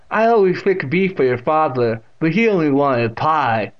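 loud deaf great-aunt's voice; Catherine. A narrator's reading. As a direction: speak out distinctly as the deaf aunt. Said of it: "with that distinct pronunciation".

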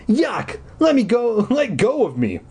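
put-on high, protesting kid voice; disgusted brother. A narrator's reading. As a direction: protest and try to wriggle free. Brother struggling out of a hug.

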